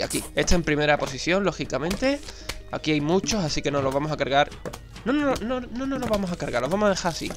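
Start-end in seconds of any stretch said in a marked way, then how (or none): 5.0-6.3 high urgent self-correction; sudden high no. Real, not acted. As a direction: stop yourself, voice jumping up. Changes plan abruptly.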